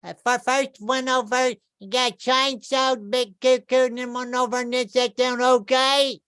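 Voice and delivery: hammed-up high Southern drawl; Southern kid. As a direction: babble directions like a rural kid. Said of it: "He's like".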